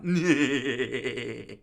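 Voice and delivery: rolling cackle trailing off; ongoing laughter. Acted. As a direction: keep the long laugh going. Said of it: "I've been laughing for the past five minutes".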